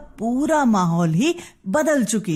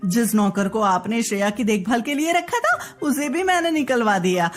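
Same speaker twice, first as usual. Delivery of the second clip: sulky demanding wife. Acted. Wife demanding a reward for housework, sulky. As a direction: insist on a reward, pouting.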